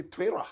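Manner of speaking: "Twitter" with an r-like tapped middle, American style; American pronunciation. Shown as a demonstration. Host showing viewers how America says it, "Twitter" as "Twerra". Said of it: "if you are from America, they say".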